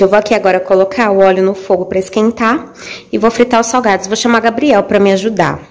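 usual speaking voice

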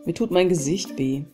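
normal talking voice

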